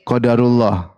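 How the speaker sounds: a touch of careless shrugging tone; breezy excuse. Retold or imitated. Wife excusing her loss with a careless qadarullah. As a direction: brush it off lightly as fate. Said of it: "Terus sang istri bilang".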